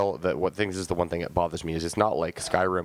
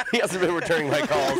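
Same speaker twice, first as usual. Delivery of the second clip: laughing. Real, not acted. Laughing through an acted quote.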